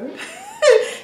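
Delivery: bright burst of real laughter; happy laugh. Real, not acted. Mother remembering the birth's end, happy laugh. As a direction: laugh out the joy.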